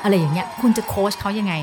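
normal talking voice

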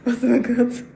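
giggling through the flubbed word; laughing at a slip. Real, not acted. Fan fumbling a song title, laughing.

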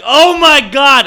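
high, loud drawn-out gasp of words; acted-out horrified reaction; shocked. Reacting to stabbing story.

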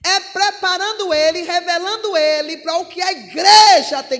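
forceful preaching. Real, not acted.

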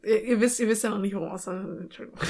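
spricht ins eigene Lachen hinein; Entschuldigung kaum rausgebracht vor Lachen; lachend. Real, not acted. (lachend entschuldigen)

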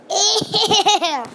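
high, drawn-out squeal of disgust; mock disgust. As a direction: laughingly imitate a grossed-out cop. Acts out cop seeing the body.

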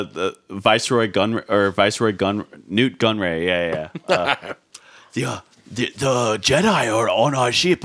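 lightly voiced alien accent, slow; a hint of the Neimoidian viceroy; Nute Gunray impression. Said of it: "Do the councilman".

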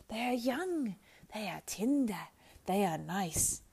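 creature voice, each phrase falling from high; pleading Gollum. A narrator's reading. Gollum craves the young rabbits, pleading. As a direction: coax hungrily in a creature voice.

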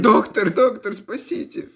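high, slow mock plea; pleading voice. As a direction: beg the doctor, hammed-up. Acted plea in a story.

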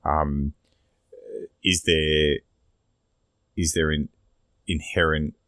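ordinary talk, nothing marked

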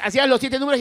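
normal talking voice

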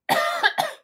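harsh chesty cough; loud cough. Real, not acted. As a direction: cough hard and high. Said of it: "I sound really ill".

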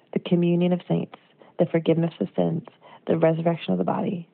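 ordinary talk, nothing marked

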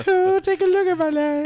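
high, wailing singer impression. Cohost mimicking a rock singer, high crooned impression. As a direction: croon it way up high in a reedy put-on voice. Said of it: "doing an impersonation of [name]".

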